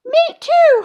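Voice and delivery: very high, loud holler; boy in class hollering high. A narrator's reading. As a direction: shout it out eagerly. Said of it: "hollered a boy".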